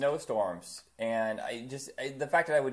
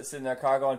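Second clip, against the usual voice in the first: ordinary talk, nothing marked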